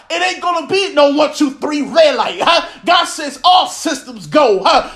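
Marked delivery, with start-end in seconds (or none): none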